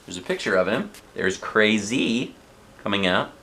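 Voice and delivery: ordinary delivery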